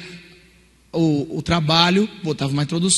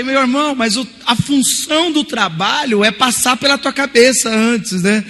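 indignant telling-off; 6 semitones higher, 4 dB louder than usual. Retold or imitated. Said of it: "Aí eu falei".

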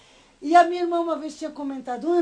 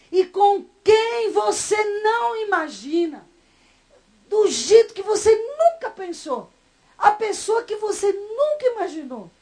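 high, loud, slowed pleading climax with a catch of tears; impassioned preaching; 7 semitones higher, 7 dB louder than usual. Real, not acted. Preacher to congregation, high, loud, tearful and slowed. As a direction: preach it like a breaking revelation.

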